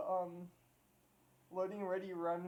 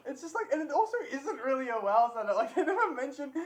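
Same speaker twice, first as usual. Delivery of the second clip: laughing; 6 semitones higher, 8 dB louder than usual. Real, not acted. Laughing while protesting the satire label.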